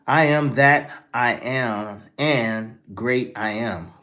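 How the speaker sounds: slowly puzzling out the words aloud; slow, baffled reading. Real, not acted. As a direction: read it word by word, confused. Slowly reading a chat message.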